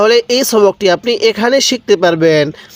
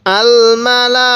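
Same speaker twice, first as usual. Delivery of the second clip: আল Mala recited slowly with the syllables stretched; drawn-out recitation; 3 semitones higher, much slower than usual. Shown as a demonstration. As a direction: chant আল Mala like a tajweed demo. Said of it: "কিভাবে শিখব?".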